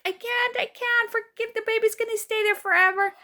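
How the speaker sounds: high, hammed-up wail of despair; mock wail like a movie labor scene; movie-style crying. Woman in labor giving up, imitated movie-style crying. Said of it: "ladies are screaming and crying".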